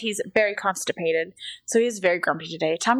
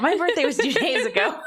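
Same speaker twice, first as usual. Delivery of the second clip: put-on child voice; 8 semitones higher than usual. Child boasting.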